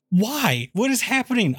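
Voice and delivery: high-pitched, mock-baffled questioning; bewildered. Viewer reacting to a movie's baffling jumps, bewildered. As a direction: push the pitch up in baffled protest.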